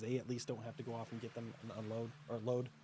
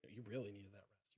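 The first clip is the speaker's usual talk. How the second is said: soft fading voice; 4 semitones lower, 11 dB quieter than usual. Acted. GM softens to a whisper.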